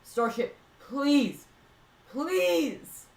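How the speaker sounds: begging, voice rising high; desperate pleading. Real, not acted. Begging rocket during final burn.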